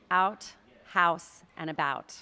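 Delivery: "out", "house", "about" with open, flat American OW; American vowels. Shown as a demonstration. Coach showing American sounds, open "out", "house", "about". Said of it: "Specifically American".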